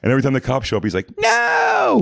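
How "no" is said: very high, drawn-out mock wail; cartoonish howl of panic; panicked scream. Caught man seeing the cops show up, panicked.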